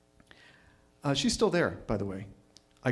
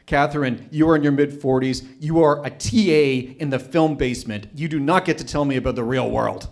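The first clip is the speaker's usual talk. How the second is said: loud, cutting put-down; scornful loud rebuke of the TA; undiplomatic retort; 7 dB louder than usual. Retold or imitated. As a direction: tell her off, loud and biting. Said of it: "I said something that was not diplomatic".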